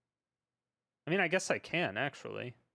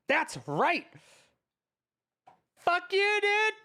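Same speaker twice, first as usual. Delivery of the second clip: high, loud victory shout; triumphant yell; 12 semitones higher, 4 dB louder, slower than usual. Real, not acted. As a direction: scream it out, gloating. Celebrating close win.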